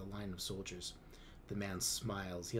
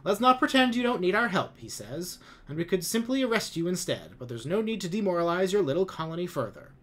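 much higher, louder patronizing speech; condescending commander; 8 semitones higher, 9 dB louder than usual. A narrator's reading. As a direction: talk down to the colonists. Lum condescends to colonists.

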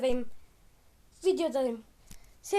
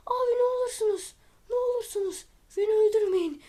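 whiny begging; 7 semitones higher, faster than usual. Real, not acted. Gamer begging enemies to spare him.

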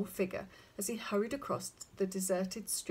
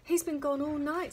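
higher-pitched anxious wife; mildly worried, pitched up; 6 semitones higher, 4 dB louder than usual. A narrator's reading. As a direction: fret aloud over someone missing. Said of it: "equally worried faces".

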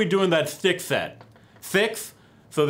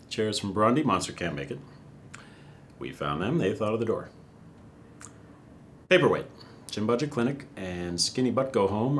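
low mumbling nonsense; 9 semitones lower, 4 dB quieter than usual. Acted. Speaker reading nonsense show names, low and mumbled. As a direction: lower mumbled gibberish names.

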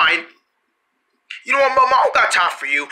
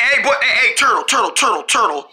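normal talking voice

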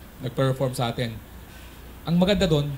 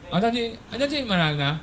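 nasal-voiced guy; 8 semitones higher than usual. Comedian voices a nasal-voiced character in joke.

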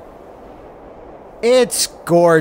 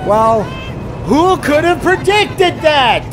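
mock innocent; 11 semitones higher, slower than usual. Voicing his character.

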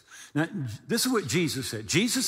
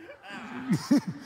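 amused laugh; 5 semitones higher than usual. Real, not acted. Laughs at story.

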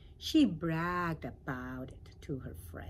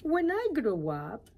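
higher eager caterpillar; lightly voiced caterpillar; 7 semitones higher, 8 dB louder than usual. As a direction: start the secret eagerly. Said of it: "she told the pollywog again".

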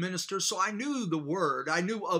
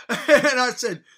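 a loud nervous laugh breaking in, slowed; laughing as he tells it; 5 semitones higher, 10 dB louder, slower than usual. Real, not acted. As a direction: laugh off the fear of the moment. Nervous laugh recalling fear.

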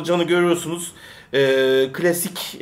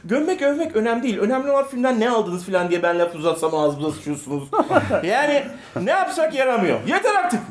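mock exasperation; 7 semitones higher than usual. Fed-up outburst at viewers.

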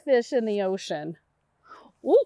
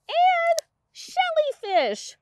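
high sing-song; 13 semitones higher, much slower than usual. Acted.

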